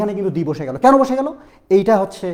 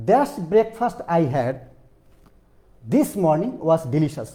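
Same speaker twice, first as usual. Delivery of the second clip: very slow, word by word example sentence; slow example read; 3 semitones lower, much slower than usual. Shown as a demonstration. Teacher reading a breakfast example very slowly. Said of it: "teacher reads example slowly".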